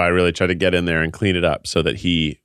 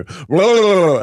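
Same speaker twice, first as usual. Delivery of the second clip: high babble; 10 semitones higher, 4 dB louder than usual. Retold or imitated. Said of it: "I'm going".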